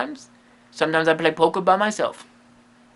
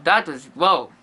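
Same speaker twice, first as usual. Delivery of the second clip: ordinary talk, nothing marked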